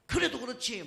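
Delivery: high-pitched imitation of a cursing driver; mock angry retort. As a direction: argue back in a put-on quarrel voice. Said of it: "막 욕하는 거예요". (높은 톤으로 맞받아치는 흉내)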